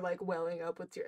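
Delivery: usual speaking voice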